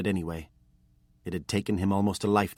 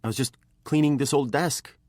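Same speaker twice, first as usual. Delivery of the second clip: put-on higher, louder boy's quick denial; nervous boy; 8 semitones higher, 5 dB louder than usual. A narrator's reading. Caught apprentice lies to his master, higher and nervous. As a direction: blurt a lie, higher.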